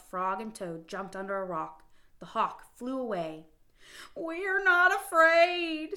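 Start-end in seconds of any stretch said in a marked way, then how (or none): 4.1-6.0 high, slow, loud scream. Acted. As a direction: scream it together in fright. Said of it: "screamed Frog and Toad".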